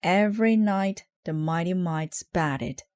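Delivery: ordinary delivery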